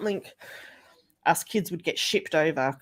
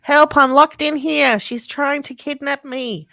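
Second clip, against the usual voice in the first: high, loud, slow mock cry for rescue; hammed-up distress; 9 semitones higher, 10 dB louder, slower than usual. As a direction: ham up a grandma pretending to be kidnapped. Nana's mock cry for help.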